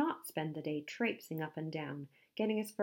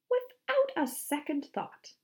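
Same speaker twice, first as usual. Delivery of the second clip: high, slow heroic declaration; pompous storybook bravado; grand declaration; 10 semitones higher, 4 dB louder than usual. A narrator's reading. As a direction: declare it boldly, drawing out each word. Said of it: "Handsome declared".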